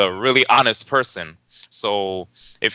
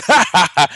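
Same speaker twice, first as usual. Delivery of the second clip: loud, higher staccato mock laugh; G Money laugh; 8 semitones higher, 6 dB louder than usual. G Money laughing at the fight story, loud mock laugh. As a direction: bark out a signature ha ha ha. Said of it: "You know, the G Money laugh".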